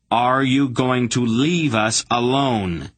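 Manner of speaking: slow, deliberate, leaning on leave; slow stern demand. A narrator's reading. Garion demanding again of the begging hierarch, slow and stern. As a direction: press the question home slowly. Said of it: "Garion demanded again".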